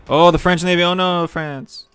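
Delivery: high, loud cry of alarm, second half drawn out slowly; alarmed outburst. Real, not acted. Player alarmed by the French navy, loud high cry. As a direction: panic at the sight of the French fleet.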